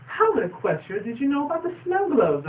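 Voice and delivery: high incredulous question; suspicious Rarity. Retold or imitated. Rarity catching the slip, high disbelief. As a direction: ask it high, not believing. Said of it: "Rarity's like".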